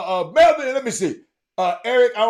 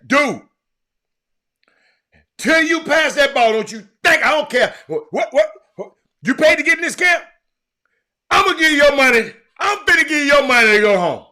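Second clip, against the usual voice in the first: scolding coach; 7 semitones higher, 10 dB louder than usual. Retold or imitated. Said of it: "I set his little ass right there".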